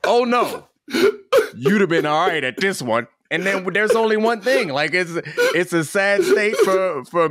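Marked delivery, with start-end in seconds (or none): 0.0-3.1 higher, teasing oh no, you'd have been all right; knowing mock reassurance. Co-host imitates the host's hint, higher and sly. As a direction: play back his line with a sly lilt. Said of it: "one of them lines where it's like".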